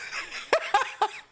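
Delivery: shrill put-on laugh; high mocking cackle. Streamer cackles after mocking the Twitter crowd, high laugh.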